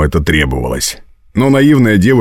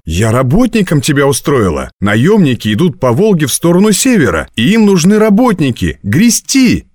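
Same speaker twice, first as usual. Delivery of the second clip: high voice, rising in excitement; eager explaining; 8 semitones higher than usual. A narrator's reading. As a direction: explain the good news, climbing higher. Girl explains the job.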